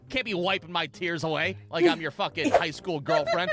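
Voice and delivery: normal talking voice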